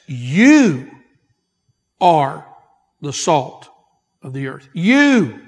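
"You" loud and stretched, long pauses between phrases; emphatic You. Shown as a demonstration. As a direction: hammer each "You", let "of the earth" drop away. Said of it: "This is the emphasis in the text".

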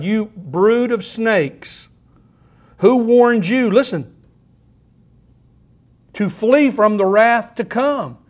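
slow, loud rebuke of the crowd; John the Baptist scolding the Pharisees, loud and slow; thundering prophet. Acted. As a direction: denounce them like a fiery prophet.

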